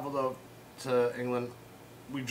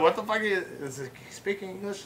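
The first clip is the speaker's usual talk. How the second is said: higher-pitched retold disbelief; mock bewilderment; 7 semitones higher than usual. Asking a friend about the drunk Scot, baffled. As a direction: ask your friend, mildly baffled.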